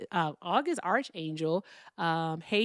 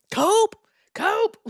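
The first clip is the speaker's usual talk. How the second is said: high, loud shrieked Cope; mock scream; 9 dB louder than usual. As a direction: screech it like the angry caller. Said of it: "Unc caller screaming, 'Cope.'".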